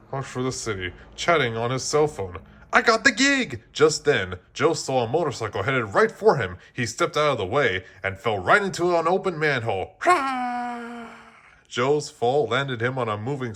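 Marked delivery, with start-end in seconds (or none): 2.7-3.6 high, loud joyful cry; excited. A narrator's reading. Joe shouts his news into the phone, excited. 10.0-11.1 high drawn-out hurrah; mock cheer. A narrator's reading. Narrator cheers Joe's fall, mock hurrah. Joe falling into manhole.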